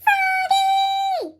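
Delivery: high shouted call across the yard. A narrator's reading. Teacher calling Froggy back across the snow, loud shout. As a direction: call him from far away. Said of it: "called Mister Mugwort".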